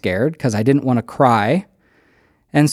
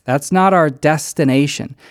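ordinary delivery

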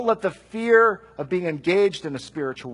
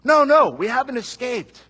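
calling out; 6 semitones higher, 5 dB louder than usual. Pretend. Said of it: "and it's like".

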